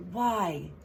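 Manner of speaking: ordinary talk, nothing marked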